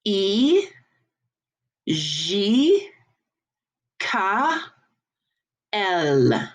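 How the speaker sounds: "E, J, K, L" as "ee, zhee, kah, ell"; French letters spaced for repeating; French letter names. Shown as a demonstration. Teacher for class to repeat, French "ee, zhee, kah, ell". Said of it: "So repeat after me".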